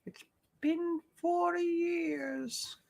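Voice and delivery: slow squeaky character line; old voice. Acted. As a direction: stretch it out like an old figure. Quoted line, odd voice.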